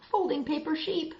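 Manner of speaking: ordinary delivery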